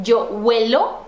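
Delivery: measured model phrases with rising ends; repeat-after-me drill. Shown as a demonstration. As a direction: recite for learners, leaving long gaps to repeat. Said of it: "repete lá".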